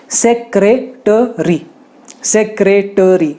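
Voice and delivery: "सेक्रेटरी" said slowly, with a full "टरी"; correct pronunciation. Shown as a demonstration. Teacher slowly modelling "सेक्रेटरी". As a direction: drag out "सेक्रेटरी", keeping the e. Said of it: "टरी कहना है".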